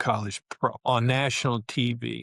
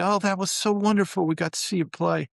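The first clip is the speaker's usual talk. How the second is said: excited parents; 8 semitones higher than usual. Retold or imitated. Parents on phone with rookie son, overjoyed. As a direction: gush like a proud mom.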